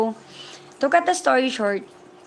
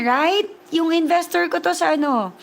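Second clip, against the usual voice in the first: usual speaking voice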